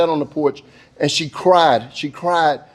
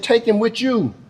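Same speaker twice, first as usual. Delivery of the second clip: higher-pitched mother's dismissal; acted insistence in a raised pitch; emphatic repeat; 6 semitones higher than usual. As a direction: push the words up high, handing him off.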